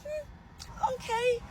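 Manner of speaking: very high, doubtful hmm then okay; skeptical hmm. Retold or imitated. Doubting mom's German story, a very high skeptical okay. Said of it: "I was like".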